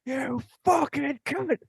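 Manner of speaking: very high, slow drawn-out yell; girlfriend's road rage yell. Retold or imitated. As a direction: scream it at another driver. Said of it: "all I hear is".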